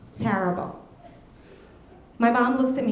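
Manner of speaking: ordinary delivery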